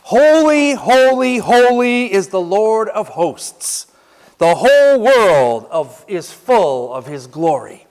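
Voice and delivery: high, loud, slow proclamation; preacher booming out the angels' praise; proclaiming seraphs' words. Shown as a demonstration. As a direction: ring out each holy like a hymn. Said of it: "Here's what they said".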